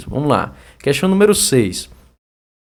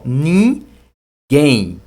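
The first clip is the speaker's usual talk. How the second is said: very slow "ninguém", NIN held long, then GUÉM; syllable split; 4 dB louder than usual. Shown as a demonstration. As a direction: stretch "ninguém" into two separate syllables. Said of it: "essa aqui é a sílaba". (duas sílabas bem alongadas)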